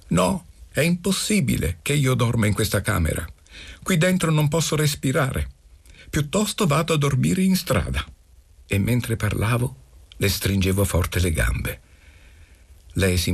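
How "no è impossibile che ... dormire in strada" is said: mild cry. A narrator's reading. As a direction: cry out in protest without real volume. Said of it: "e gridai".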